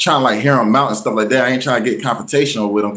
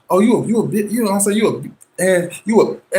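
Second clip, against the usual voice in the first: high, mocking angry rant; imitating her flipping out; 10 semitones higher than usual. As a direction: retell the ex cursing at you. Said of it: "she started flipping on me".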